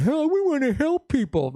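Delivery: high, whiny mock do-gooder voice; sneering high-pitched imitation. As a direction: parody a naive hippie's plea. Mocking hippie doctors' plea.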